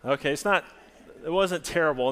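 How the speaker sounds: ordinary delivery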